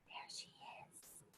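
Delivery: very soft, slow whisper; barely audible hushed aside. A narrator's reading. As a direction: whisper it so as not to disturb her. Keeps whispering after the hush.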